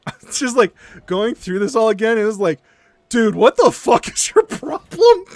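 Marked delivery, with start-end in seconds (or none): 3.1-5.2 exasperated outburst. Retold or imitated. Retelling outrage at the doctor.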